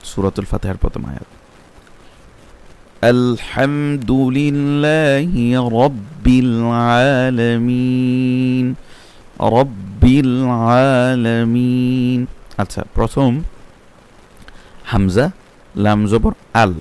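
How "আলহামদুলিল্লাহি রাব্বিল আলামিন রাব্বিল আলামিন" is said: slow melodic tilawat of Fatiha; Quranic recitation. Shown as a demonstration. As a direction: chant the verse slowly and evenly. Teacher recites Fatiha verse. (ধীরে সূরা ফাতিহা তিলাওয়াত)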